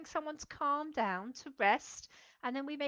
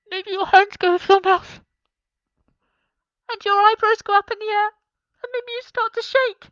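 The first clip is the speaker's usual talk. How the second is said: much higher and louder, gasping scared voice; mock frightened; 9 semitones higher, 13 dB louder than usual. Shown as a demonstration. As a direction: play scared for the children. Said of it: "What about when you're frightened".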